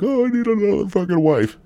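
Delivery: high-pitched mocking whine; mocking dopey husband. Divorced man craving another marriage, mocking. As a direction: mimic a needy fool in a high, stretched voice. Mimicking men who want to remarry.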